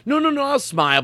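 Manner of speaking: high-pitched mock protest; imitating Vinny's thoughts. Host mimicking a listener who won't crack up, high mock protest. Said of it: "That's what he thinks".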